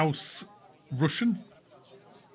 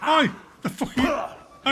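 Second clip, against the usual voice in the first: yelling in surprise; loud startled shout; 11 semitones higher, 4 dB louder, slower than usual. Real, not acted. Brawl breaks out in game.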